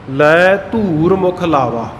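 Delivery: slow recitation, ਧੂਰ drawn out with long oo; long vowel in ਧੂਰ. Shown as a demonstration. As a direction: say ਧੂਰ as "dhoor", long oo held. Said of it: "ਧੂਰ ਸ਼ਬਦ ਜਿਹੜਾ ਧੱਦੇ ਨੂੰ ਦੁਲੈਂਕੜ ਨਾਲ ਹੈ".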